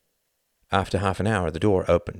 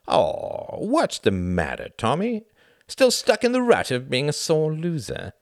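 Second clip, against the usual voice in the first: mock-concerned taunt; 10 semitones higher, 4 dB louder than usual. A narrator's reading. Reginald goading Tom as a sore loser, taunting. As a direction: needle him like a child, pitch leaping up.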